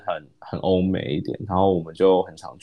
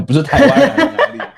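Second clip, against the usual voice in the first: laughing disbelief; 9 dB louder, faster than usual. Retold or imitated. Inner thought mocking the question.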